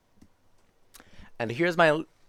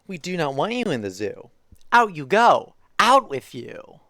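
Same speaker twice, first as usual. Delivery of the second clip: louder, firm shooing; zookeeper shooing out; 4 semitones higher, 6 dB louder than usual. Acted. Zookeeper ordering the spotted creature out, loud and firm. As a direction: chase him out of the zoo with a raised voice.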